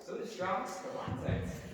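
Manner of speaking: soft, half-whispered repeat; near whisper. Real, not acted. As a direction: murmur the names back quietly.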